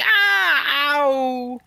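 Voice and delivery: high, loud hammed-up ow; mock pained cry. Voicing Luffy crying out in pain, hammed-up. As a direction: whimper like a hurt kid. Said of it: "And Luffy's there like".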